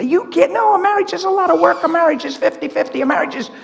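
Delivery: high-pitched mimicry of a critic; mocking objection. Preacher mimicking psychologists who would crucify him, mocking objection.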